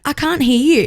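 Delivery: high singsong prompting; strict mum. Retold or imitated. Mum waiting for manners from daughter, high-pitched prompting. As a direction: imitate mum pretending not to hear. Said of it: "And Kelsey's like".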